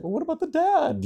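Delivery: squeaky mock outrage; mock indignant question. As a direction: playfully protest on behalf of the dad. Mocking a mother-side-only view.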